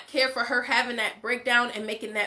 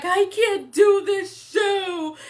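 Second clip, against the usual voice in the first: high, loud, drawn-out mock wail; mock crying; 10 semitones higher, 8 dB louder, much slower than usual. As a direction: ham up a sobbing protest, slow and shrill-loud. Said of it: "She's crying".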